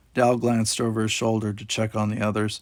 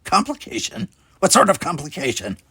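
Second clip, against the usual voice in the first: much higher shocked outburst; alarmed, angry repeat; angry demand; 8 semitones higher, 4 dB louder than usual. A narrator's reading. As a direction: demand answers furiously. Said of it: "anger flashing across her features".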